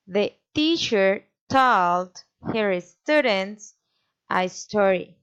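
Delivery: slow beats on TEACHER, TOLD, STUDENTS, STORY; measured demo hitting TEACHER, TOLD, STUDENTS, STORY; four stresses. Shown as a demonstration. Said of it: "A statement with four stresses".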